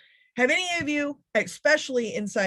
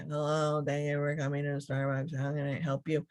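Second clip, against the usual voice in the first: lightly voiced bored drive-through clerk, softer; a hint of a tired clerk going through the motions; unperky barista; 6 semitones lower, 6 dB quieter than usual. Speaker imitating the Starbucks speaker box, mildly bored. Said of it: "They're not very perky".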